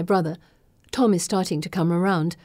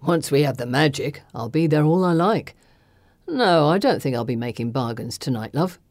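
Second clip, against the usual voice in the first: low, sneering kidnapper; gruff villain voice; 4 semitones lower than usual. Acted. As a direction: lower the voice, mock her. Falson voiced by narrator.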